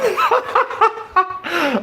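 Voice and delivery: high squealing laugh; real, helpless laughter. Real, not acted. Watching Robert on the clip, high squealing laugh.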